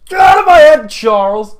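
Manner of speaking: high, loud furious yell; angry shout. Retold or imitated. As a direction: scream it at the man in your head. Voicing Mystique's outburst.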